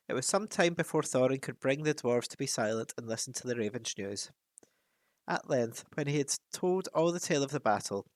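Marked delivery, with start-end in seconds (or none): none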